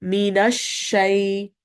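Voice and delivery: Minash shay slowly with a wide spreading sh; spread sheen. Shown as a demonstration. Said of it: "You're still holding the, the spreading".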